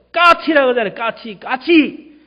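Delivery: an exasperated son losing patience; irritated shout. Acted. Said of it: "소리를 지릅니다". (치매 아버지에게 짜증 내며 외치는 아들)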